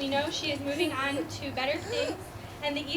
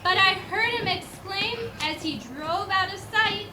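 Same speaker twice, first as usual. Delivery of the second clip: steady storybook recitation; poem reading; 3 semitones higher than usual. Acted. Reading the poem to the school audience, storybook recitation. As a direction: read the old Christmas poem aloud. Reads Christmas poem aloud.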